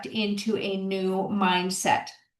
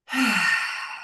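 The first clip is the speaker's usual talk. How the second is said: long slow breath out, letting it all go; deep audible sigh; 2 semitones lower than usual. Shown as a demonstration. Coach guiding viewers to breathe, deep sigh. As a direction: exhale deeply as if to relax. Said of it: "Take a deep breath with me".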